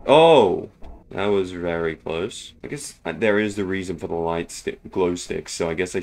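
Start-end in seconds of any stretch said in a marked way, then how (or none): 0.1-0.7 loud gasp of fright; startled shout. Real, not acted. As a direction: cry out at the near miss. Near miss with enemy.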